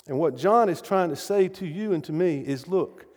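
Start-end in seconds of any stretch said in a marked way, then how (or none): none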